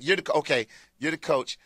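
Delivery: usual speaking voice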